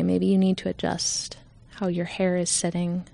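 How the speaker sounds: calm, unhurried meditation voice with long pauses; guided meditation. Real, not acted. Leader guiding listeners to settle in, calm meditation voice. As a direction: ease listeners gently into stillness.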